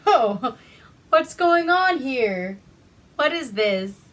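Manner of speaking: loud, high, drawn-out wondering; mock surprise. Herman stumbling on a long line, loud mock surprise. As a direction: play up the innocent curiosity loudly. Voices Herman finding a crowd.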